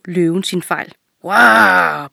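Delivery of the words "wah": loud drawn-out roar; lion's roar. A narrator's reading. Lion realising the fox has tricked it, roaring. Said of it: "brølede den".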